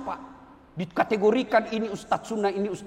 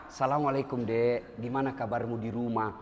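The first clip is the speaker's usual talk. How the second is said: soft, low and kindly; friendly greeting; 12 semitones lower, 4 dB quieter than usual. Acted. Officer greets a young soldier, soft and kindly. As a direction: greet him like a caring officer.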